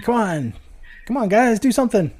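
high, playfully exasperated pleading; mock frustrated. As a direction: act out goading the ghosts, pitch jumping up. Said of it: "I was getting so mad".

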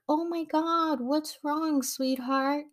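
high, sugary mock-sweet concern; fake concern. The chief fakes concern for the sobbing junior, high mock-sweet. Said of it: "pretending like".

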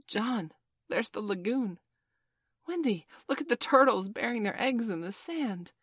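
high, delighted calling out; excited. A narrator's reading. Children spotting the lagoon and turtles, excited. Children hailing the island's sights.

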